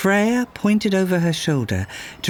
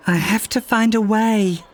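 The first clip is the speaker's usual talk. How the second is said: insisting; 7 semitones higher than usual. Acted. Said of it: "Rue insisted".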